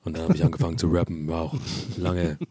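deep, low rapper impression; low, lazy Rostock rapper voice; [name]. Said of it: "dein [name]".

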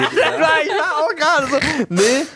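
high, loud startled squeak; shocked high yelp. Real, not acted. Reacting to a friend's edgy joke, high startled squeak. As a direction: recoil in mock shock.

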